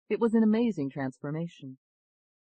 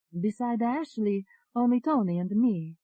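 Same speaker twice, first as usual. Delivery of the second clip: put-on Italian accent; 7 semitones higher, 5 dB louder than usual. A narrator's reading. Second alter answering the doctor, Italian accent. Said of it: "You have an Italian accent".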